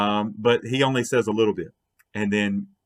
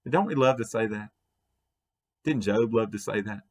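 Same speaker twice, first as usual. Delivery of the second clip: ordinary talk, nothing marked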